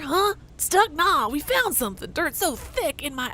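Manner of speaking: high-pitched gruff miner. Acted. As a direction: squeak it out like a dusty old miner. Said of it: "I've changed his accent four times".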